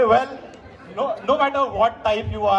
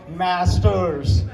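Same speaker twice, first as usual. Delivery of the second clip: lower-pitched put-on American accent; fake American accent; 5 semitones lower than usual. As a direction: ham up an American pronunciation. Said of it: "that's the fake American accent".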